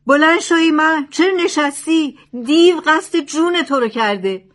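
high, loud urgent shout. A narrator's reading. Thief yelling at the sleeping holy man, shouting. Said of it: "فریاد کشید".

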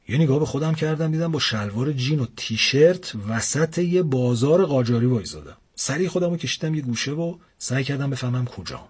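usual speaking voice

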